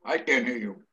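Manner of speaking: talking while chewing; words round a bite of food; mouth full. Real, not acted. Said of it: "that's 'cause you're chewing".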